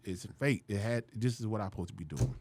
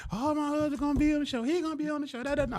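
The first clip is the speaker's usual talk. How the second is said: much higher, sing-song boast; proud bragging. Retold or imitated. Wife bragging to the salon, high and proud. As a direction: mimic the wife showing off.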